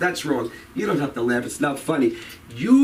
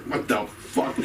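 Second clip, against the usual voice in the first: imitated audience guy; 8 semitones lower, 5 dB quieter, slower than usual. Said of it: "He's going".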